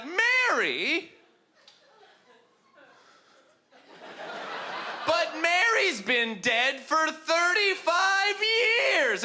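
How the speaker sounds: put-on high, slow old lady voice; lightly voiced old woman, surprised. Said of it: "she'd be like".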